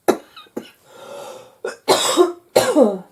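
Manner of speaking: harsh loud coughing; real cough, hacking hard; coughing fit. Real, not acted. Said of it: "I'm still coughing".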